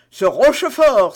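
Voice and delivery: Porthos bursts out loud and indignant; exclaiming. A narrator's reading. Porthos exclaims hotly about Rochefort, loud outburst. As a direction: blurt the name out, loud and heated. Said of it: "s'écria Porthos".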